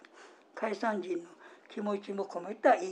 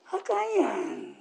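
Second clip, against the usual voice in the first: high, drawn-out Osaka-dialect reproach; demonstrated Osaka-dialect scolding; 10 semitones higher than usual. Shown as a demonstration. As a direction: swoop up and stretch it, Osaka style. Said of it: "大阪弁のお話".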